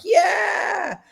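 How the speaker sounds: high, excited yell; crowd cheer. Retold or imitated. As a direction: cheer like a crowd going wild.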